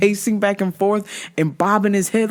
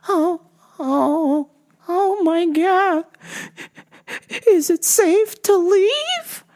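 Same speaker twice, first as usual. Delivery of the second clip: put-on higher, slow trembling old lady; sweet old lady; 9 semitones higher, much slower than usual. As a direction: play a frightened little old lady.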